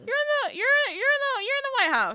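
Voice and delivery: very high, loud, stumbling squeal; mock frantic exclaiming. Host to absent [name], squealing in mock urgency. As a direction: squeal it, restarting as you go.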